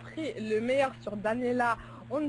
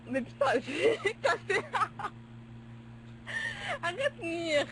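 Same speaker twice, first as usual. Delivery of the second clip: cracking up; 8 semitones higher than usual. Real, not acted. Reader breaks down laughing, scolds friend.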